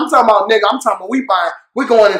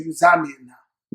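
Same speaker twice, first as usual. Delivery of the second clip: slow, low and quieter, like a pronouncement; solemn elder; 9 semitones lower, 7 dB quieter, slower than usual. Retold or imitated. Village elders telling the visitor he belongs, slow and grave. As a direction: declare it gravely and slowly. Elders declaring him Zambian.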